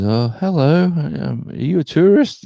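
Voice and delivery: high, chirpy checkout lady; supermarket lady. Retold or imitated. Tasmanian checkout lady greeting a newcomer, high and chirpy. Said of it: "the lady at the supermarket says".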